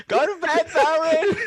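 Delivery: high, loud shout up the stairs; calling out. Acted. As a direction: yell up to his room. Player shouting upstairs to Valerin.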